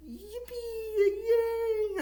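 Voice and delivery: squeaky high-pitched cheer; a falsetto yippee that breaks into a cry; mock squeal. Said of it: "I mean, he doesn't say that".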